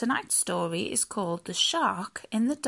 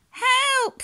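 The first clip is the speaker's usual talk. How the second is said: very high, loud cry for help; flapping panic; 5 dB louder than usual. A narrator's reading. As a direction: shriek like a panicking storybook fish. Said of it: "flapped the fish".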